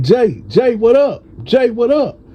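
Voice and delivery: high, excited hail to a friend; calling out. Retold or imitated. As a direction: call his name out, pitch jumping way up.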